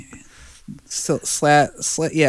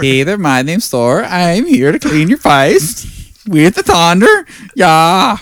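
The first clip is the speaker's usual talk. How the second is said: louder, higher brash hero voice; mock Thor; 6 semitones higher, 6 dB louder than usual. Friends joking about Thor's accent, playing a brash Thor. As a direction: swagger like a dumb god.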